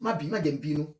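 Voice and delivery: normal talking voice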